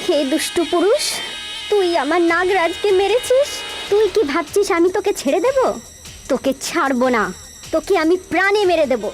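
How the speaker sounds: high, fierce cursing vow; vengeful fury. Acted. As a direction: curse him with burning rage. Snake-woman vows vengeance on killer. (ক্রুদ্ধ নাগিনের প্রতিশোধের শপথ)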